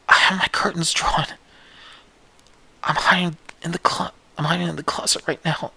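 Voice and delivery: scared whisper in a closet; whispering while hiding. Acted. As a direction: whisper so nobody hears.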